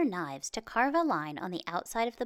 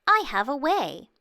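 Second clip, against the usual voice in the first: higher and much louder announcement; called out; 6 semitones higher, 9 dB louder than usual. A narrator's reading. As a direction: proclaim the idea proudly to all. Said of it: "Chang then called out".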